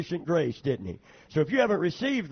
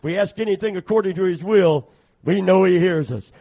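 preaching; 5 dB louder than usual. Acted. Preacher building to full force.

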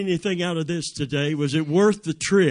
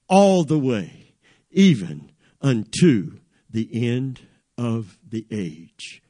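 repeated phrases growing louder and slower, dropping low at the close; building emphatic declaration; 3 semitones lower, 4 dB louder than usual. Acted. Preacher proclaiming God's promise to go with you, with mounting force. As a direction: build to a forceful drawn-out peak, then come down.

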